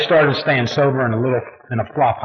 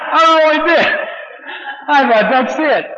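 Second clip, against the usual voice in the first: laughing; 3 dB louder than usual. Real, not acted. Laughing recall of despair.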